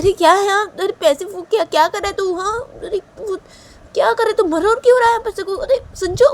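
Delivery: high, loud exclamations at her son; agitated scolding mother. Acted. मां घबराकर बेटे को टोकती है. (ऊँची आवाज़ में डाँटती माँ)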